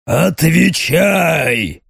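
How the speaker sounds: slow, shouted monster command; demonic shout. Acted. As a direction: bark the order.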